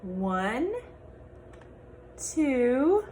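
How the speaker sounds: lively picture-book read-aloud with character voices; reading to children. Acted. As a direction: read it to a class of little kids.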